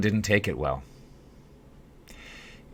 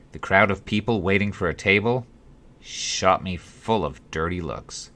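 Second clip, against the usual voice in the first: plain reading voice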